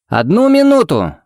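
high-pitched call from another room. Acted. Pharmacist to unseen visitors from the storeroom, calling out high. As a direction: sing out from the back, voice way up. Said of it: "раздавшееся по ощущениям из какой-то кладовки".

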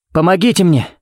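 cry for help. A narrator's reading. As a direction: call out for help. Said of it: "крикнул он".

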